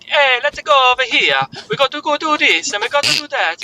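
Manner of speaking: high mock Italian Mario voice; Mario in an over-the-top Italian accent. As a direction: bounce it like a cartoon Italian plumber. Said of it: "your Italian accent".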